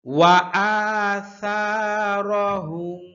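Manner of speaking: আসারহুম with সা held one alif long; standing fatha stretch. Shown as a demonstration. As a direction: stretch the সা clearly. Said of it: "খাড়া জবর হবে". (খাড়া জবরে সা লম্বা করে পড়া)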